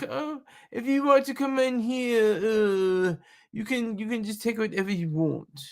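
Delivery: a bad, hammed-up French accent; terrible French accent. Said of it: "That's my terrible French accent".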